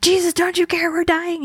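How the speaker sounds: frantic plea from a sinking boat, hammed-up; panicked disciples. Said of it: "everyone's freaking out and they're like".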